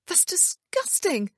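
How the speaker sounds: high, slow cry of disgust. A narrator's reading. Wife revolted by the news, crying out. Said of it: "Emma cried".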